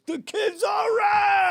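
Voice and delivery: booming drawn-out shout of approval. Retold or imitated. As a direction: bellow it slow to the whole room. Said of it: "he goes".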